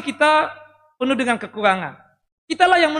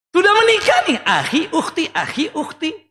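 mocking; 5 semitones higher, 4 dB louder than usual. Mocking couples using akhi ukhti.

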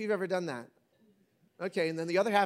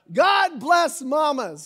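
slow emphatic blessing; 7 semitones higher, 8 dB louder, much slower than usual. Real, not acted. Preaching praise of mothers.

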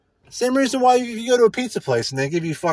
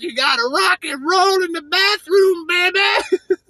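playful jive DJ voice, pitched way up; Wolfman Jack impression; 11 semitones higher than usual. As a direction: stretch it out like a wild late-night DJ. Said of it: "it's like".

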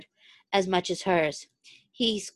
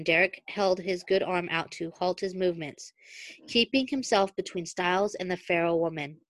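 ordinary delivery, nothing marked

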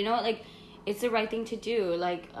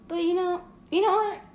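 excited; 11 semitones higher, 4 dB louder than usual. Real, not acted. Deciding to reveal the secret.

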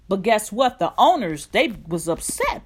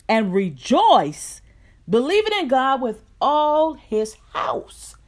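fervent rising scripture reading, louder; preaching scripture; 3 semitones higher, 5 dB louder than usual. Acted. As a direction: read the passage like a preacher building fire. Scripture read with rising force.

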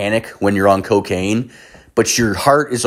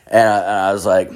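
ordinary talk

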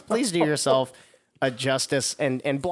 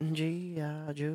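soft cartoon tagline; 3 semitones higher, 8 dB quieter, much slower than usual. Quoting PSA tagline.

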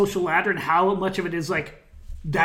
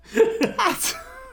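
high laugh. Real, not acted. Laughs at own story.

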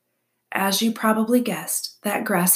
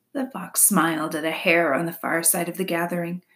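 ordinary delivery

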